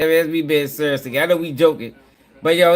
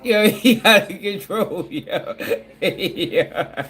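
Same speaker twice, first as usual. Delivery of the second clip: laughing; much slower than usual. Real, not acted. Laughing at clip reaction.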